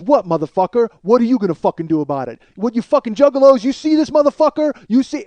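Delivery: loud, rising challenge shouted at a crowd; angry taunt. Retold or imitated. Rapper taunting the guard who threw his fan back, angry taunt. Acted taunt from Violent J onstage.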